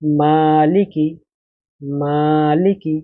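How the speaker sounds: মালিকি read slowly, মা held one alif long, লি কি quick; slow drawn-out reading. Shown as a demonstration. Teacher shows students how to read মালিকি, slow and drawn out. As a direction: stretch the মা in মালিকি, clip লি and কি. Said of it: "লাম এবং কাফ এই দুটো তাড়াতাড়ি উচ্চারণ করতে হবে".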